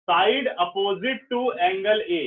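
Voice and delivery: slow, word by word dictation. Shown as a demonstration. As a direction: dictate it slowly to the class. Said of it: "ऐसे लिखो".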